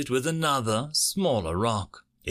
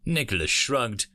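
ordinary delivery

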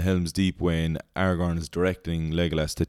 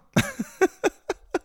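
high laugh; 3 dB louder than usual. Real, not acted. Laughing at own impression.

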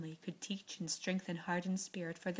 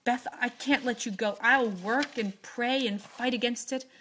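loud, defiant, rising to a cry; rebellious cry; 5 semitones higher, 9 dB louder than usual. A narrator's reading. Joe refuses to let Beth die, loud defiant cry. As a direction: fight fate with every word. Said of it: "cried poor Joe rebelliously".

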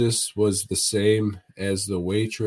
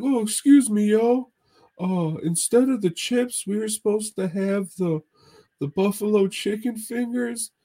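much higher, goofy imitated friend's voice; Mahoney impression; 10 semitones higher than usual. Friend ordering appetizers from the waitress, high goofy imitation. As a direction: mimic a buddy's high, hesitant ordering voice. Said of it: "It's like".

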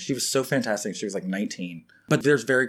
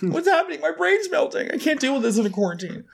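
very high-pitched comic meltdown; mock panic. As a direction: squeal as if your mind is giving way. Comic meltdown over bad French.

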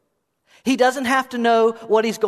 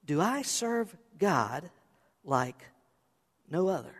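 soft, slow self-questioning; hushed, searching inward question; asking oneself; 8 dB quieter, slower than usual. Shown as a demonstration. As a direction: ponder it quietly, pausing between phrases. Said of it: "Just ask it to yourself".

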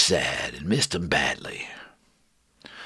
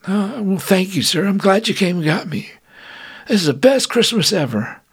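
put-on young soldier voice; 10 semitones higher, 4 dB louder than usual. Lefty voiced.

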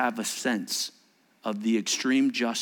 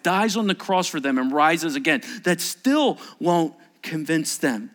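raised pitch, driving emphasis; impassioned preaching; 6 semitones higher, 4 dB louder than usual. Real, not acted. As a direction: press the argument home, slowing at the end.

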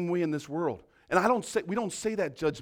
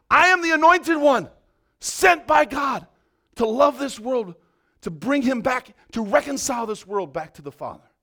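proclaiming shout; 7 semitones higher, 11 dB louder than usual. Retold or imitated. Preacher voicing a declaration of being sent, shouting. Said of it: "stand up like Jesus did and say".

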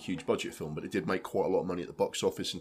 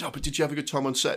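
fast perky interviewer; 7 semitones higher, faster than usual. Retold or imitated. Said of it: "He goes".

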